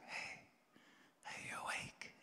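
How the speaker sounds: hushed, gentle wake-up whisper. Retold or imitated. Parent gently whispering to a sleeping child. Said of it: "very gently just go like".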